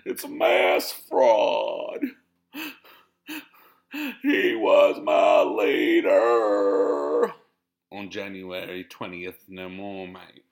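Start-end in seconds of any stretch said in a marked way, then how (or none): 0.0-7.3 loud, slow, sobbing, sing-song wail; a hammed-up crying protester; mock wailing supporter. Mocking a sore loser with a loud, slow, sobbing wail. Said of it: "one of those people that was complaining".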